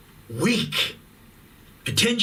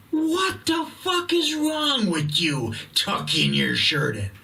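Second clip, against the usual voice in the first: exasperated outburst; 6 semitones higher than usual. Real, not acted. Outraged rant at shirt tuckers.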